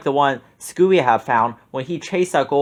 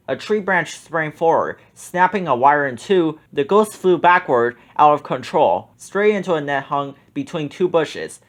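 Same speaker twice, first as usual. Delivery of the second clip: ordinary delivery, nothing marked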